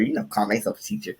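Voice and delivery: ordinary talk, nothing marked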